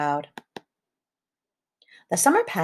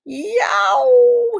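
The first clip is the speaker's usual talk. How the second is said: very high, loud scream of pain; wolf lands in boiling water and screams; wolf's yelp of pain; 7 dB louder than usual. A narrator's reading. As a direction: shriek as if scalded. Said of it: "screamed the wolf".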